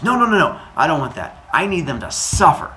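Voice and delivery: put-on high, loud boyish objection; vehement protest. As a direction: burst in loudly, then insist on revenge. Boy protests, demanding revenge.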